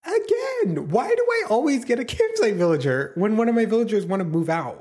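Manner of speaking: high-pitched exasperated complaint; whiny, high outburst of disbelief; exasperated wail. Real, not acted. Exasperated at another campsite villager.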